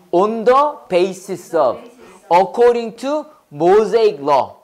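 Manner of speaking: very slow, word by word; slow model reading. Shown as a demonstration. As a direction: spell it out slowly for the class. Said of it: "다 해봐, based on". (천천히 또박또박 읽어 주기)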